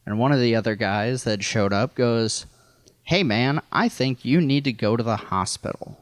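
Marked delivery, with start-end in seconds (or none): none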